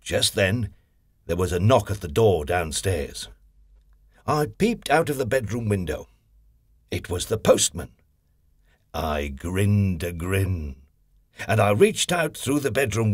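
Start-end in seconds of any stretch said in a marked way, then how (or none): none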